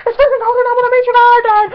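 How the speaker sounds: high, loud frantic squeal; building to a fast, loud overexcited cry; freaking out. Retold or imitated. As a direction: wail it hysterically into the phone. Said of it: "I'm, like, freaking out".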